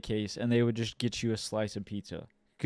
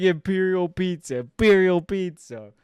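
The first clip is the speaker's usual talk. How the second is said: a raised, higher repeat of Imperial Pizza; imitating pizza guy; 6 semitones higher, 7 dB louder than usual. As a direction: parrot the pizza man's catchphrase. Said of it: "He kept saying".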